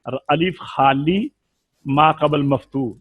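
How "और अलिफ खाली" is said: normal talking voice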